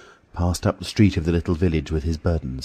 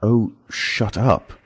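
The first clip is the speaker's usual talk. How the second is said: a sudden forceful, slow shut up; vigorous snap; 6 semitones higher, 4 dB louder, much slower than usual. Acted. Invisible man silencing Marvel with sudden vigour. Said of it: "with sudden amazing vigour".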